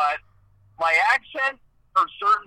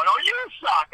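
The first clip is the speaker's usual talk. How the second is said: higher, playful "you suck" with stress on "you"; mock comeback; 8 semitones higher than usual. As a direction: fire back a joking retort. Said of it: "You know".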